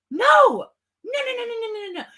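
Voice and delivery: high, loud string of no's; real outrage at the mother's story; outraged shout. Real, not acted. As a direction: shout them down in disbelief. Outraged over Laundrie mother's claim.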